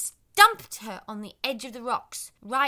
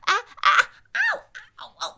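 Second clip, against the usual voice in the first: pained yelps; 11 semitones higher, 8 dB louder than usual. Acted. Evie slipping and hurting.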